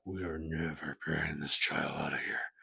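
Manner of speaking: low, quiet vow; acted defiant resolve. Parents privately refusing to remove their baby, low defiant vow. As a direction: say it softly under your breath, stubbornly.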